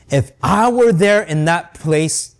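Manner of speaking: ordinary talk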